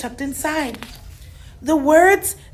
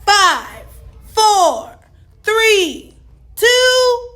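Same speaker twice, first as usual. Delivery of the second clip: rising, louder countdown; 8 semitones higher, 4 dB louder, much slower than usual. A narrator's reading. Astronaut counting down to launch, rising and loud. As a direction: build to launch.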